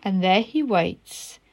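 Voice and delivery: storybook read-aloud voice; story narration. A narrator's reading. Teacher reading a story to pupils, gentle storytelling. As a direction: read the picture book to children.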